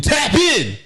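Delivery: high mock yell of a livestream hawker; imitated screaming. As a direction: holler it like an annoying seller.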